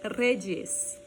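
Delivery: REDES said "rredjes", trilled R. Shown as a demonstration. Teacher modelling the plural, "rre-djes". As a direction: turn the Y into "dj" in redes. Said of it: "verificar essas variantes também no Y".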